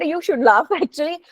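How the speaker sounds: ordinary talk, nothing marked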